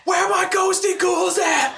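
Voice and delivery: very high, slow calling voice; silly calling voice. Pretend. Calling to the ghosts, high slow voice. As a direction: call out to ghosts in a squeaky singsong.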